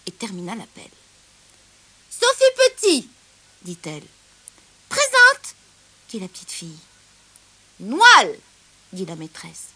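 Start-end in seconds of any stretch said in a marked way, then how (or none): 2.1-3.1 high, loud teacher calling out a name; roll call. Acted. Teacher calling the register in class, loud roll call. 4.9-5.5 high, loud schoolgirl reply; pupil's roll-call answer. Acted. Little girl answering her teacher's roll call, high loud call. 7.8-8.3 loud teacher calling a name; roll call. Acted. Teacher calling the lion at roll call, loud call.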